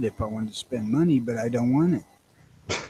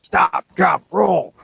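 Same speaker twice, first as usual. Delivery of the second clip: raised, punched one-word commands; higher-pitched joking drill call; mock safety command; 6 semitones higher, 4 dB louder, much slower than usual. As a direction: call it out like a fire drill, in jest.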